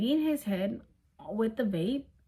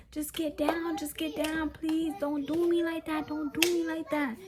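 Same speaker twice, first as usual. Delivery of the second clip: higher, whiny begging; mock pleading boyfriend; 6 semitones higher than usual. Boyfriend begging her not to leave, higher whiny plea. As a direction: beg her to stay in a pitched-up voice. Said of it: "He's like".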